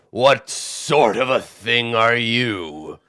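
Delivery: slow, loud giant questioning the stranger; a towering giant quizzing the little furbolg; curious giant. Acted. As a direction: lean in and ask it like a curious giant. Giant voice continues.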